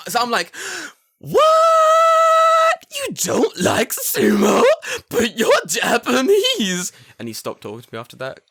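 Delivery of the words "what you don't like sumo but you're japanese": piercingly high, drawn-out shout; mock outrage. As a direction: scream it in hammed-up shock, stretching each word. Throwing his mock outrage back.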